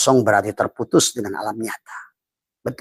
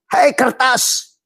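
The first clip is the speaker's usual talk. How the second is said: calling out; 13 semitones higher, 4 dB louder, much slower than usual. Acted. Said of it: "addressing paper in character".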